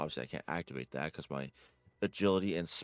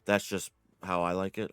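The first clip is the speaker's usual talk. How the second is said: usual speaking voice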